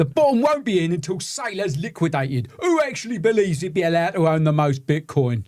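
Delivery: put-on Cockney reading; Cockney accent. Host reading Clown Cockney's chat comment, Cockney accent. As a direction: read it out as a playful Cockney. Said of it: "Shall I do this in a Cockney accent?".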